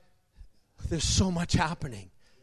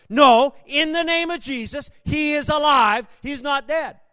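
shouting with rising boldness; bold shout; 6 semitones higher, 6 dB louder than usual. Retold or imitated. Son shouting at the doctor, bold loud declaration. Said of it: "boldness started to come out even more".

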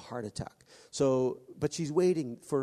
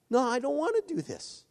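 reluctant protest; 11 semitones higher than usual. Pretend. Voicing reluctance to God.

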